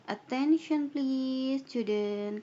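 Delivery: high, loud and slow classroom call. Shown as a demonstration. Teacher demonstrates calling students, loud and slow. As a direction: stretch it out loud to get attention. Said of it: "kata asking for attention-nya apa".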